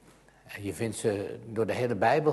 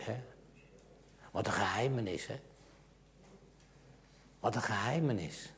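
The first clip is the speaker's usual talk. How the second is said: ordinary talk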